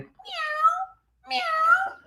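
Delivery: very high, soft mew; cat meow. Pretend. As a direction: mew like a tiny kitten. Cat sound in children's song.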